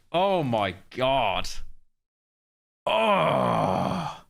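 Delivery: ordinary talk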